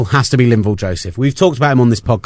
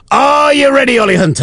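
game show host; 11 semitones higher than usual. Pretend. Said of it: "I wasn't quite doing it in the Gladiator's voice".

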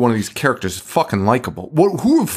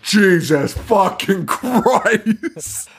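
long drawn-out, high-pitched exasperated curse; mock exasperation; 6 semitones higher, much slower than usual. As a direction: stretch out every word in mock despair. Acting exasperated father entering house.

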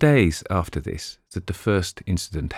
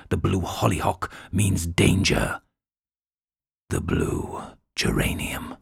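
ominous prophecy; slower than usual. Acted. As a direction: read it like a curse, fading to a hush. Reading the menacing letter.

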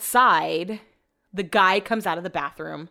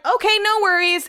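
very high, sing-out fake-nice reply; fake cheerful; 12 semitones higher, 4 dB louder than usual. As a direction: chirp it high to cover annoyance. Retold falsely easygoing reply while annoyed.

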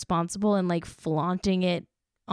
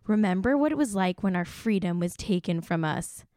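ordinary talk

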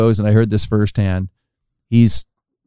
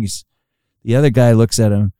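normal talking voice

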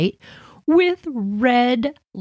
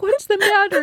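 put-on concerned boys; 13 semitones higher than usual. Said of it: "they're like".

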